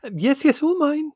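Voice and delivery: high flustered twitter; nervous high fluttery reply; twittering Mother. A narrator's reading. Mother flustered answering the customs man, high twitter. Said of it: "twittered Mother".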